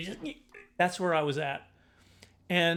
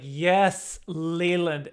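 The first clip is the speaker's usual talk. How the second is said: teacher; 9 dB louder, much slower than usual. Retold or imitated. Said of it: "she's like".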